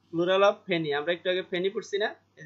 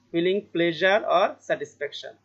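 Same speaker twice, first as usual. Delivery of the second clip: slow reading; much slower than usual. Shown as a demonstration. Teacher reads definition slowly.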